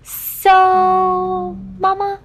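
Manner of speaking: high, drawn-out "so" before each name; Asa. Retold or imitated. Cohost teasing the host by mimicking Asa, high and drawn out. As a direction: imitate a child calling on parents, stretched and high. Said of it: "You sound like Asa".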